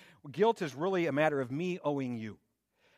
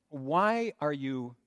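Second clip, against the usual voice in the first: very slow, word by word, dropping away at the end; deliberate, drawn-out "Why are you angry?"; slow insistent question; much slower than usual. Shown as a demonstration. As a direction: press the question home slowly. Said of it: "No, no, no".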